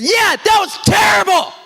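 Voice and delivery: loud, high retold shout; angry yell. Coach shouting at the umpire who ignored him, loud and high. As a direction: snap back at him hard.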